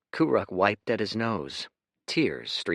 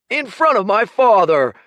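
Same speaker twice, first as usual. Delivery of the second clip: high, very loud furious yell; 15 dB louder than usual. Acted. Kuruk yelling at Dorian over the humiliation, furious. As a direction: scream it in his face.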